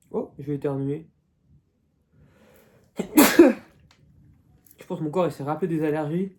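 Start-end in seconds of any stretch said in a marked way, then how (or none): 3.0-3.6 loud sudden sneeze; real sneeze, sharp and loud; sneezing. Real, not acted. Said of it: "Et voilà, éternuement".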